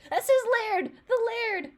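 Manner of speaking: high, loud, thrilled exclamation; excited discovery. Real, not acted. Fan spotting the Peter Laird reference, thrilled. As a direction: shout it out as you spot it.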